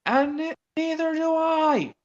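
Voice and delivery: high, slow cross voice; cross Percy. Retold or imitated. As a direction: finish the scolding in a high voice. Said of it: "said Percy crossly".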